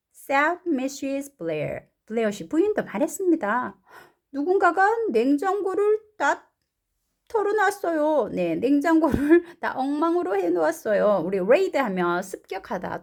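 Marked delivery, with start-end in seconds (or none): 4.3-8.3 high-pitched character voice; raised storybook voice; indignant story mother. Acted. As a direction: act out mum's complaint. Mrs. Blair's line in translation.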